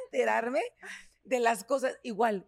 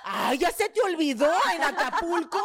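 louder, slower, laughing tease; teasing cousin; 5 semitones higher, 5 dB louder, slower than usual. Retold or imitated. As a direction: needle her like the cousin did. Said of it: "agarra y me dice".

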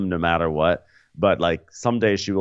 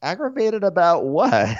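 slow, higher fake-puzzled; mock Melissa; 11 semitones higher than usual. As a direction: ask it stretched and high. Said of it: "Melissa's like".

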